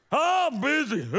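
busy blurted out loud and high; mock frazzled. Pastor acting a frantic churchgoer, loud busy. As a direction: throw it out like you're swamped. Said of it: "we're like".

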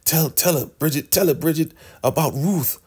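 high, insistent pushing; high nagging prod to speak up; pushy high plea. Retold or imitated. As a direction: urge her on in a high pleading voice. Elder Mother pushing Bridget to speak.

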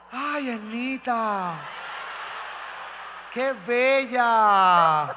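very slow swooning sigh, laughing; swooning fan. Retold or imitated. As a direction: melt over the diva. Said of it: "hasta los hombres están".

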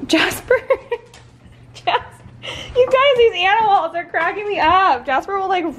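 high, loud words breaking into giggles; real laughter through the line; laughing while talking. Real, not acted. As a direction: barely hold it together laughing. Laughing at the dogs.

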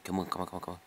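normal talking voice

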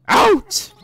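loud, high yelled dismissal; shouted order. Acted. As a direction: yell it to throw them out. Game character orders them out.

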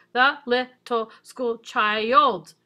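put-on "The little school child" slow, each syllable separate, "child" stretched toward two. Shown as a demonstration. Teacher rechecking the syllable count, "The little school child" said syllable by syllable. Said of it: "counting syllables for a second".

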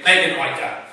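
loud mock-frustrated student outburst; frustrated retort. Greek students retorting to their professor, mock frustrated. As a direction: throw it out forcefully, imitating an exasperated student. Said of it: "if we get frustrated with the professor".

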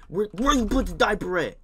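put-on high, loud exasperated question at a toddler; exasperated demand. Babysitter demands the diaper from the baby, high and loud. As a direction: demand it like a frazzled sitter.

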